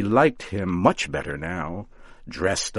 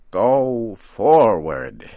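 slow, louder command; commanding witch; 3 semitones higher, 8 dB louder, much slower than usual. A narrator's reading. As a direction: order him forward, drawn out.

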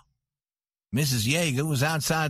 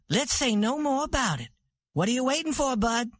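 higher, brisk woman's voice; proud ranch queen; 9 semitones higher than usual. A narrator's reading. Santa hurrying Bud away, brisk and high. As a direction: cut the argument off.

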